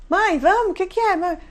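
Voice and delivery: put-on high, whining kids in the back seat; mock child whine; impatient kids. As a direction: play the bored children. Kids in car voiced.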